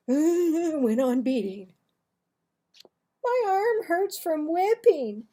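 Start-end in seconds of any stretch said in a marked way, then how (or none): 3.2-5.3 whined. Acted. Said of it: "I whined".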